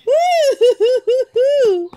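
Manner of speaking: high, bright laughing whoop. Real, not acted. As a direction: let the laugh burst out. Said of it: "I can't help but laugh".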